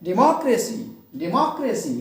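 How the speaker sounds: Democracy as de-MOCK-racy, मॉक stressed; stress on middle syllable. Shown as a demonstration. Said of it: "मॉक पे क्या कर देंगे". (Democracy में मॉक पर ज़ोर)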